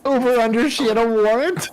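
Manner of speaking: shocked, high and loud; can't believe what you just heard; surprised. Real, not acted. Reactor stunned by the reveal.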